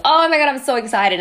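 picture yourself winning and gush; excited. Retold or imitated. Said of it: "I would put myself in that image thinking".

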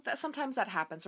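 normal talking voice

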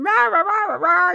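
high, loud mock yelling gibberish. Sister yelling at her brother, high loud mock rant. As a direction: squawk it like a nagging sister. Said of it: "she was yelling at him".

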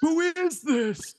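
put-on high, slow old lady voice; old lady character. Sisters doing a phone bit, high slow old lady voice. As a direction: quaver it out like a confused granny. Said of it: "I'll be like".

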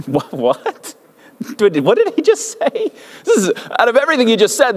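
a stunned double take from heaven; comic disbelief. Retold or imitated. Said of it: "God's like".

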